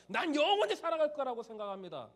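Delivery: mock confident declaration. Preacher voices an imagined thought.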